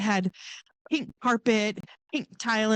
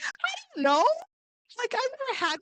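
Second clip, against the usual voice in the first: panicked. Retold or imitated. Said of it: "I'm like".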